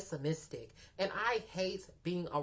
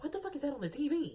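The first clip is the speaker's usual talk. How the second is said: shocked; 8 semitones higher, much faster than usual. Retold or imitated. Shocked at a roach on screen.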